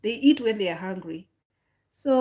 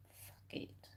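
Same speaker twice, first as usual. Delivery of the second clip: fed-up whisper under the breath; a fed-up whispered curse under the breath; 24 dB quieter than usual. Real, not acted. Fed-up aside.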